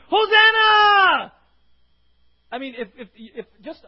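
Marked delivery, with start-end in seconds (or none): 0.1-1.3 long, full-throated shout, much higher and louder; crowd shout. Shown as a demonstration. Preacher voicing the crowd's roar at Jesus, huge shout. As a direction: shout Hosanna like thousands at once. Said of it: "the Bible says they shouted".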